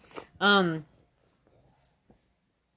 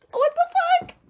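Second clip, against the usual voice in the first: mock outrage; 4 dB louder, slower than usual. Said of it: "I was like".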